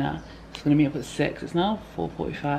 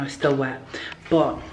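ordinary talk, nothing marked

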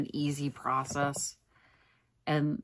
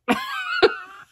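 giggle; 6 dB louder than usual. Real, not acted. Tired giggle over earning cookie.

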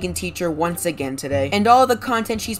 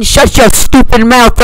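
angry shout; 4 semitones higher, 17 dB louder, slower than usual. Acted. Snapping at the vegan teacher.

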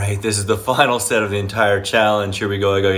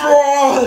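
strained effort cry; 14 semitones higher, 6 dB louder than usual. Real, not acted. Finishing final rep.

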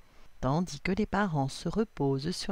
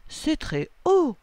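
high, slow, louder wonder; impressed agreement; 10 semitones higher, 5 dB louder, slower than usual. A narrator's reading. Assa agrees the cliffs are towering, impressed agreement. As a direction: stretch it out, impressed. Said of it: "confirme Assa".

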